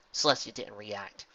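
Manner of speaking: usual speaking voice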